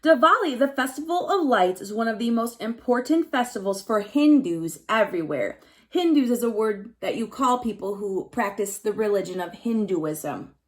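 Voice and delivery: ordinary talk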